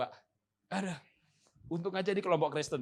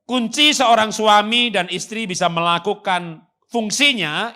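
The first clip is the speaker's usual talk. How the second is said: emphatic preaching; 7 semitones higher, 14 dB louder, slower than usual. Acted. Preacher stressing key point.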